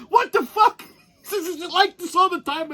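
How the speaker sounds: high, frantic whine; a man at the end of his rope; distraught buddy. Retold or imitated. Snowed-in buddy pleads about the snow, high-pitched. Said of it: "He goes".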